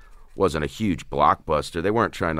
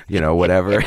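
ordinary talk, nothing marked

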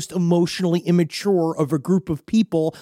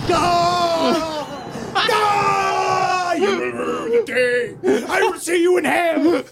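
1 hammed-up villain despair; 10 semitones higher, much slower than usual. Rod's melodramatic reaction to banishment.

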